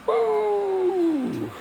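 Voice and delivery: high gleeful whoop; excited woo. Real, not acted. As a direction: whoop with delight at the light. Flips the light switch on.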